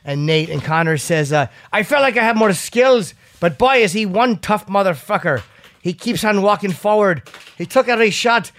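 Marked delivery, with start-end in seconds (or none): 1.7-8.5 a raised, louder stab at an Irish brogue; hammed-up Irish accent doing Conor; acting out a fighter's post-fight praise in a bad Irish accent; bad Conor McGregor impression. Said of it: "worst Conor McGregor impression".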